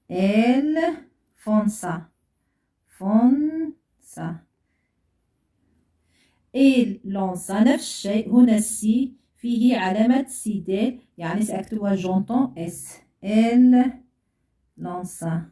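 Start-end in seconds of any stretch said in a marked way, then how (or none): 0.1-4.3 "Elles font ça" drawn out, word by word; slow dictation. Shown as a demonstration. Teacher dictates slowly while writing. 13.2-15.4 slow dictation. Shown as a demonstration. Teacher dictates slowly while writing.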